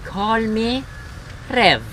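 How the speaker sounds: high, slow talking-gun voice; slow revolver character. Pretend. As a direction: play a revolver introducing itself, drawn out. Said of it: "I should probably talk slowly".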